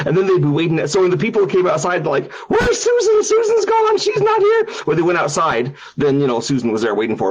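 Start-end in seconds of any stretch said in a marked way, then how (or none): 2.5-4.7 very high squealing alarm; mock panic. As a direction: imitate frantic visitors in a falsetto. Visitors panic over missing Susan.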